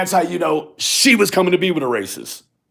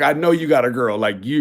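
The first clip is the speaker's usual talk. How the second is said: normal talking voice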